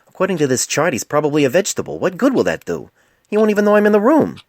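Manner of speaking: exasperated doctor, voice climbing to a cry. Acted. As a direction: throw up your hands, exasperated. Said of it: "Painless looked exasperated".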